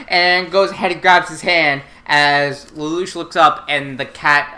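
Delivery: usual speaking voice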